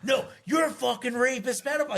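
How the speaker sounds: high-pitched mock yell; half-yelled, insistent accusation; retold screaming. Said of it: "He starts screaming this at me".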